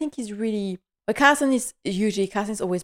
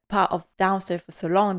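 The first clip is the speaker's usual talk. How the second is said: normal talking voice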